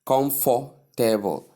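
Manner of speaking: slow COMFORTABLE as KOM-FOR-TAY-bol; every syllable stressed. Shown as a demonstration. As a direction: equal stress on first three syllables of "comfortable". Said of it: "placing stress on all".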